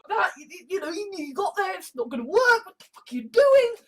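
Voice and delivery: high, rising to a quick burst; exasperated scolding. Retold or imitated. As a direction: imitate an exasperated telling-off, building in pitch. Acted exasperated scolding of someone.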